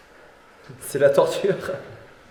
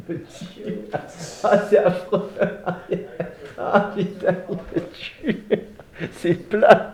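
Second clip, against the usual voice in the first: laughing in pain; 3 semitones higher, much slower than usual. Real, not acted. Painful massage, laughing.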